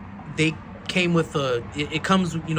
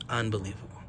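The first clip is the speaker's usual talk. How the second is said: moved; 5 semitones lower, 9 dB quieter, faster than usual. Real, not acted. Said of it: "I'm getting emotional".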